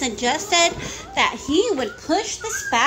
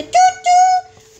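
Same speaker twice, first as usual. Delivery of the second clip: high, loud, drawn-out toot-toot; train whistle imitation; 10 dB louder than usual. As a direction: hoot like a little train.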